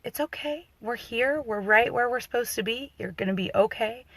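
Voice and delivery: calming reassurance. Retold or imitated. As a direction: quietly settle a frightened mother. Doula calming panicked mother.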